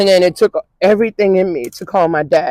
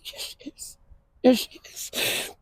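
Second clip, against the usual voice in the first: almost soundless, slowing with grief; grieving whisper; 5 semitones higher, 15 dB quieter than usual. Real, not acted. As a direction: voice gone to a tearful whisper. Overcome retelling death to father.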